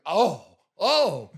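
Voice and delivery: high, loud oh of pain; pained moans. Retold or imitated. Actor moaning as the paramedic cuts the tie. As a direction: moan as the tie is cut.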